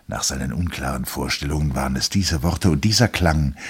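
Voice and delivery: usual speaking voice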